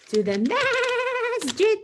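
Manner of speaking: high, slow puppet punchline; lamp puppet voice. Acted. Lamp puppet gives the punchline to the kids, high and slow. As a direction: drag out the answer in a squeaky puppet voice. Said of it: "It's gonna say".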